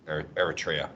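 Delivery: ordinary talk, nothing marked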